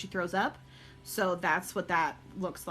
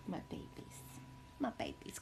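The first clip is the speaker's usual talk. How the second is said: soft cooing; 14 dB quieter than usual. Real, not acted. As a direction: murmur fondly to the pups. Cooing softly to her dogs.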